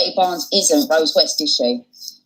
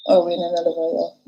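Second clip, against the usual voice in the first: ordinary delivery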